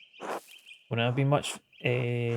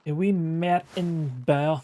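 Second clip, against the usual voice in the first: cooing; 5 semitones higher, 4 dB louder, much slower than usual. Pretend. Cooing over the character.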